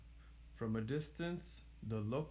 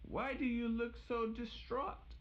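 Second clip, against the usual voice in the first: high, kindly granny voice; sweet old grandmother; 13 semitones higher than usual. Acted. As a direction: welcome her in sweetly.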